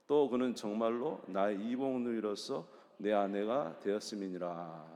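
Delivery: loud, steady group reading of the verse; scripture read aloud. Real, not acted. Leading the congregation in a loud scripture reading. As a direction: read it out together, firm and even.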